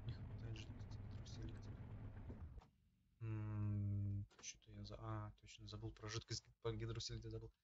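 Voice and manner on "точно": ordinary talk, nothing marked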